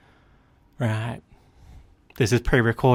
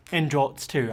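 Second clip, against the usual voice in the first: ordinary talk, nothing marked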